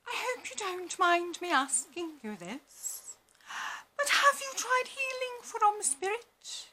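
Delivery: high, soft, slow pitying voice; gushing sympathy. Retold or imitated. Stranger offering spiritual healing, high soft pitying voice. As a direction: coo it like a do-gooder. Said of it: "they'll say something like".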